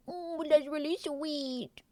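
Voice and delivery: slow, soft touched coo; hushed slow aww. Real, not acted. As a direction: melt a little at the kind gesture. Touched by the gift.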